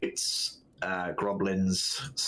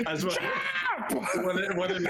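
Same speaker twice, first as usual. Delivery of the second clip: laughing; 10 semitones higher than usual. Real, not acted. Laughing while narrating.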